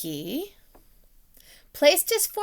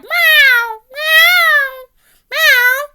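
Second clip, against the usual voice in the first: very high, loud drawn meows; a loud cat's cry; 15 dB louder, much slower than usual. Acted. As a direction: meow like a calling cat. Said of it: "And the cat meowed".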